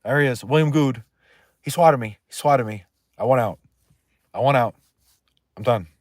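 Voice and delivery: mock panic, spotting him in the crowd; hammed-up scared victim; imitating a panicked man. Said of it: "He'd be like".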